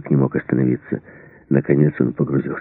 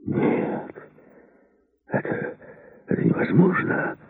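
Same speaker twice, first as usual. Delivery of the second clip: faint, halting near-whisper; stunned disbelief; 8 dB quieter, slower than usual. A narrator's reading. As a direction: stammer it softly in shock, breaking off between words. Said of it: "От этих слов Мердок даже пошатнулся". (ошеломлённо, почти шёпотом)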